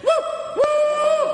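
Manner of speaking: high falsetto hooting woo calls; bunyip mating call. Retold or imitated. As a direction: hoot it like an animal calling in the bush. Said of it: "I heard it's coming closer".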